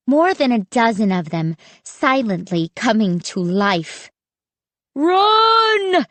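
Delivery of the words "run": high, loud panicked scream. A narrator's reading. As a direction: yell it out in terror to get them moving. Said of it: "Styx was screaming".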